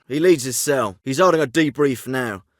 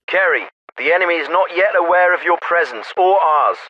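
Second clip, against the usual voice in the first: military officer over a field radio; crisp orders through a narrow radio channel; radio transmission. Acted.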